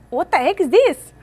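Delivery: high, loud incredulous question; real baffled outburst. Real, not acted. Vlogger puzzled while setting up, loud baffled exclamation. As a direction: blurt it out loudly, thrown off.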